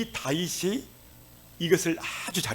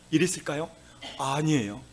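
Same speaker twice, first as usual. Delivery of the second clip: ordinary talk, nothing marked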